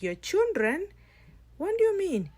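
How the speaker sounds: high, loud cry of disbelief; shocked loud disbelief. Acted. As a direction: shout it out, thrown by the news. Bride learns he's their father.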